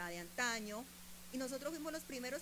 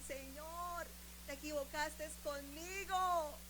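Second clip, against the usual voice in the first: mock wailing complaint to God, higher toward the end; tearful retold plea; 6 semitones higher than usual. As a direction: plead like an upset young wife praying. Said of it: "empecé a llorar y decirle".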